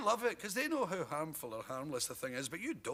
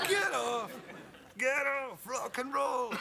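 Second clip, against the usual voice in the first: heckling crowd; 9 semitones higher, slower than usual. Retold or imitated. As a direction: jeer like a rock crowd.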